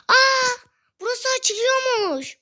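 high-pitched delighted surprise; surprised discovery. Real, not acted. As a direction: exclaim as if just finding it, pitch high. Discovers a hidden opening.

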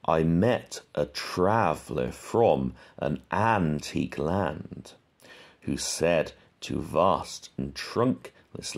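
slow recitation that leans on every second syllable; scanning the meter. Shown as a demonstration. Teacher scanning Ozymandias slowly to show the iambic stresses. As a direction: read slowly and hit each stressed beat. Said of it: "Is it iambic pentameter?".

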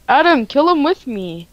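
ordinary talk, nothing marked

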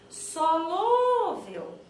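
slow, high, loud SOLÚVEL with a long LÚ; calling out word. Shown as a demonstration. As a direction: call SOLÚVEL as if at the gate. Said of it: "Eu vou chamar a palavra solúvel no portão".

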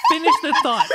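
loud laughing plea; laughing while insisting. Real, not acted. Laughing, urging her to finish.

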